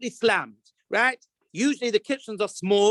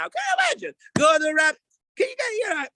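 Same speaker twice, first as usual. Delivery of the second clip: excited disbelief; 11 semitones higher than usual. Real, not acted. The rabbi imagining Gordon Ramsay's reaction, shouting excitedly. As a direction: cry it out, delighted by the chaos.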